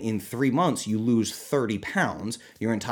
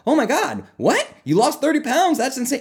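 high, loud, mock astonished gasp; fake surprise; 13 semitones higher, 7 dB louder than usual. Coach mocks fake shock at client's weight loss. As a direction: ham up shock at big news. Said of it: "I can't, like, fake".

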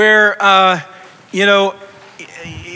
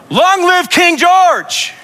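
slow, much higher shouted toast; loud yell; 10 semitones higher, 4 dB louder, much slower than usual. Retold or imitated. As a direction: bellow it across the tavern. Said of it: "he yells out real loud".

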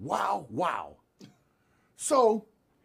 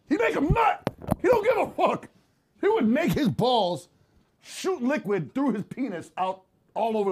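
very high, loud mock-lawyer outburst; hammed-up shouting; 7 semitones higher, 6 dB louder than usual. Mock lawyer proves how close the homies were, impassioned high shout. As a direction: shout the absurd claim in a raised voice.

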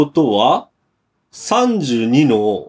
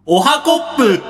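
high, bouncy morning greeting; playful greeting; 12 semitones higher than usual. Acted.

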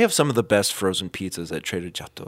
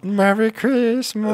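sing-song Merry Christmas; high, slow festive greeting; 13 semitones higher, 6 dB louder, slower than usual. Acted. Joke greeting.

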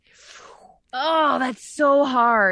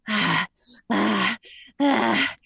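drooling hungry sounds; much slower than usual. Shown as a demonstration. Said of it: "That sounds too sexual".

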